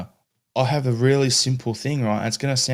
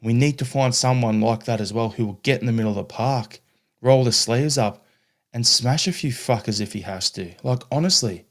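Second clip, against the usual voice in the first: usual speaking voice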